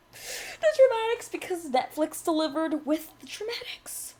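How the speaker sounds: high, breathy stage whisper; mock-dramatic whispery flourish; theatrical. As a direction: draw it out with hushed, hammed-up drama.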